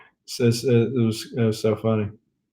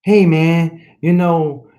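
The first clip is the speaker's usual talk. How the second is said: imitated friend, higher and louder; Rob; 5 semitones higher, 4 dB louder, slower than usual. Channelling his inner buddy for the viewers, imitated voice. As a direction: put on a buddy's higher-pitched voice. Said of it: "I gotta channel my inner Rob".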